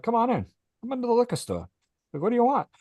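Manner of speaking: high, loud mock welcome into the liquor store; playful host. Teller welcoming his neighbor into the garage, mock grand and high.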